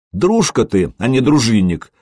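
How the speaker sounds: plain reading voice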